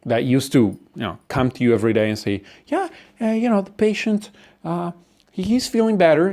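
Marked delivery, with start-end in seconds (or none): 2.7-6.3 lightly acted doctor, pitched higher, hesitant; doctor's update. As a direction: hedge good news like a cautious doctor. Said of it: "it's like a doctor".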